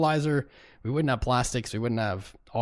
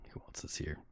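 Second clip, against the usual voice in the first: whispered question; breathy whisper to chat; 8 semitones lower, 15 dB quieter than usual. Real, not acted. Whispered aside while reading names.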